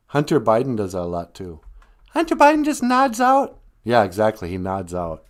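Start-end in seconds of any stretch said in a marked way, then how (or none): 2.1-3.6 very high, loud sidekick voice; high-voiced sidekick. Sidekick agrees with host about the sleeping guest, high character voice. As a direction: squeal it out in a high, excited character voice.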